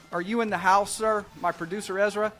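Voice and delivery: normal talking voice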